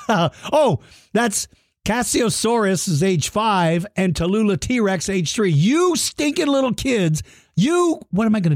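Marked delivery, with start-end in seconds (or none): 5.5-8.5 higher mock-cranky growl at the kids; playful monster scolding; grumpy El Stinko. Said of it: "and the El Stinko screaming".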